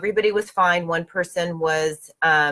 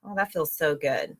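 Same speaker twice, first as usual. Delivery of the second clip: ordinary delivery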